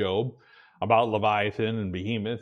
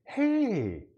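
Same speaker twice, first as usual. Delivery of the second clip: happy hey; 10 semitones higher than usual. Retold or imitated. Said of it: "and you're like".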